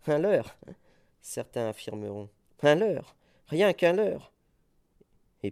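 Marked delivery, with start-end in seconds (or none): 0.1-0.6 mock claim. Poet mocks doubters' claim. 2.6-4.3 loud, scornful declamation of a delusion; scornful cry. Acted. Poet scoffing at the sceptics, loud and scornful.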